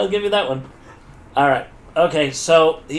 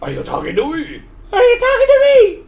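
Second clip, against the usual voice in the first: hammed-up challenge, wildly swinging pitch; silly movie-line voice; 11 semitones higher than usual.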